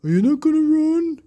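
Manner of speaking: high, loud wailing ghost; spooky ghost. Acted.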